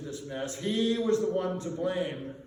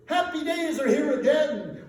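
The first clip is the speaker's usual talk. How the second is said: much higher and louder, laughing with joy; joyful cheer; 10 semitones higher, 10 dB louder than usual. Acted. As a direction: burst out like a celebration. Merchants' joyful cheer quoted.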